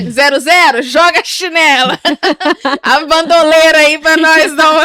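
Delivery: loud, high comic call with laughter; laughing battle cry. Acted. Joking about hurling the slipper at the child, loud and laughing. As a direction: shout it jokily through laughs.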